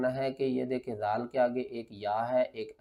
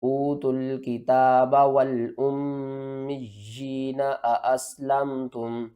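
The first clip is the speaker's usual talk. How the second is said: Quran recitation; 4 dB louder than usual. Acted. Teacher reciting the verse for students, melodic. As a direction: recite the verse in measured, tuneful tarteel.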